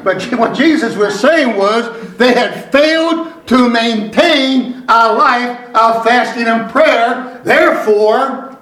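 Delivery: slow, deliberate pulpit delivery; measured preacher cadence with weighty stresses; emphatic preaching. Real, not acted. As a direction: drive each phrase home to the congregation. Preaches to the congregation.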